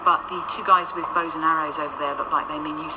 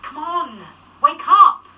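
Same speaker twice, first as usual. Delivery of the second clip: loud urgent call, higher; calling to wake her; 8 semitones higher, 8 dB louder, slower than usual. Acted. Mary calling out to wake Parker, urgent shout. Trying to wake unconscious partner.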